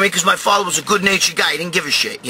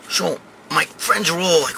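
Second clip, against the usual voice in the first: ordinary talk, nothing marked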